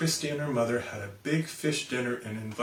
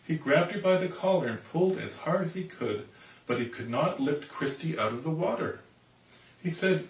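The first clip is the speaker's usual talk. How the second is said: plain reading voice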